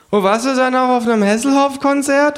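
hohe, laute Figurenstimme, fragend; Känguru. Acted. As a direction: mit hoher Stimme nachbohren. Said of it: "fragt das Känguru". (hohe Känguru-Stimme)

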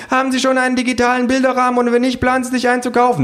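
high, rapid-fire questioning; shrill, breakneck survey voice; badgering pollster. A narrator's reading. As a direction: rattle off the question fast and very high. Mob of pollsters fires questions.

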